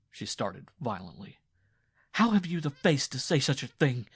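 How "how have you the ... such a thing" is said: higher, louder wounded protest; wife denying her husband's accusation; indignant wife. A narrator's reading. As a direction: throw it back at him, offended.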